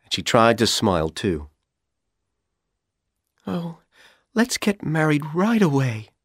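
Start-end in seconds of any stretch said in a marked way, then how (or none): 3.5-6.1 1 Dorothy's voice. A narrator's reading. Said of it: "she said".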